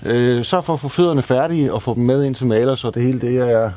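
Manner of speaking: ordinary delivery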